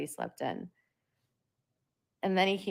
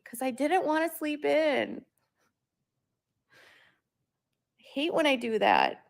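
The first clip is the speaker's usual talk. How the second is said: put-on higher, louder whiny little-kid voice; imitating cranky kid; 10 semitones higher, 5 dB louder than usual. Mother voicing her cranky son, high and whiny. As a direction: whine it like a grumpy toddler. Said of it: "it threw off his routine".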